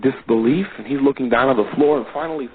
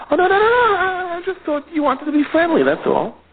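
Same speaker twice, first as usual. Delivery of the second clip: high-pitched "no, no, no" denial; flustered backpedalling. Acted. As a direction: back off fast in a high squeal. Backpedalling in an acted exchange.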